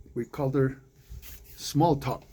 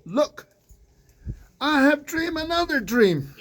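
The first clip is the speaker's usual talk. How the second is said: eager boasting Joseph; 12 semitones higher, 3 dB louder than usual. Acted. Joseph boasting to his brothers.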